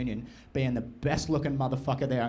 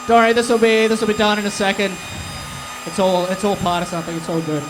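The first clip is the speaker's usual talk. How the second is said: much louder, higher shouted patter; shouting over crowd; 6 semitones higher, 8 dB louder than usual. Real, not acted. Comic to crowd during chaos, loud high shouted patter. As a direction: project over the roaring crowd.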